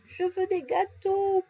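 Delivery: put-on high, slow childish wail; tearful child. A narrator's reading. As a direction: whine it like a crying toddler. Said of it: "pleure le bambin".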